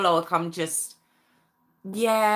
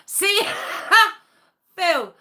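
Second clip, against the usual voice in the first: laughing; 7 semitones higher than usual. Real, not acted. Laughs about a name coming up.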